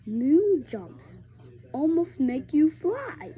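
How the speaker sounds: ordinary delivery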